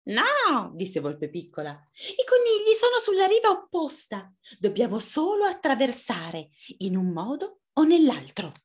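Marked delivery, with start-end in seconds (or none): 0.0-0.7 high little fox no; tiny fox objecting; little fox refusing. Acted. 1.7-8.5 bossy small fox planning; little fox, determined. Acted.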